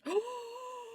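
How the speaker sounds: sudden high shocked gasp; sharp gasp. Real, not acted. Streamer gasps at broken tracking, shocked. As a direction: gasp in real alarm. VTuber panics at tracking failure.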